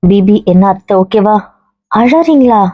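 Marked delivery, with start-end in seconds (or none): 1.9-2.6 surprised. Real, not acted. Aunt suddenly starts crying.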